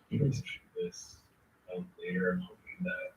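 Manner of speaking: faint distant replay through speakers; screen recording. Real, not acted. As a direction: echo of own stream playback. Stream audio playing back.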